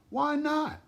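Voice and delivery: squeaky little question; high curious question. A narrator's reading. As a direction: ask it like a small child.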